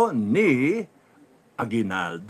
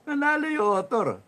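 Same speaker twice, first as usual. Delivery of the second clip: incredulous mock; 9 semitones higher than usual. Mocking the winning author.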